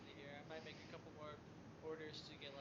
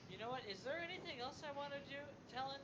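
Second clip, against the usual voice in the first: excited; 11 semitones higher than usual. Real, not acted. Raised pitch idea.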